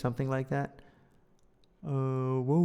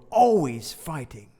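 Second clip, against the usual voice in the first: louder take with always punched; firm, louder reading of always fighting; louder retake; 7 dB louder than usual. Shown as a demonstration. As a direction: say always fighting with force, always stressed. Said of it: "We could do that one a different way".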